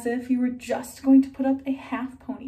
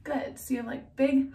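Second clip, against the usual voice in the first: ordinary talk, nothing marked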